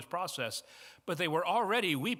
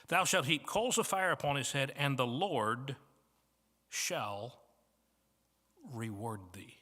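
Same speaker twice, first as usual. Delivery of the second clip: solemn scripture reading. Shown as a demonstration. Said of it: "Solomon goes on to say".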